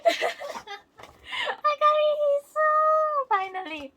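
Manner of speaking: high, loud, slow shouted joy through laughter; screaming laughing joy; ecstatic scream. Real, not acted. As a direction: scream it out like you just won. Finally got her bias.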